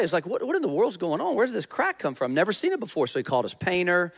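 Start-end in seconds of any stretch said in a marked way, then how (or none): 0.2-3.1 high-pitched, astonished disbelief; blown away. Retold or imitated. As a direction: retell it as a baffled, higher-voiced reaction. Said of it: "He was blown away".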